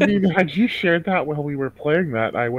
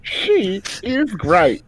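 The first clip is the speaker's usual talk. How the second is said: slow, higher Southern sales pitch; Southern infomercial; 5 semitones higher, slower than usual. Pretend. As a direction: sell it like a drawling Southern TV ad. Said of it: "Southern infomercial".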